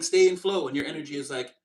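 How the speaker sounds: ordinary delivery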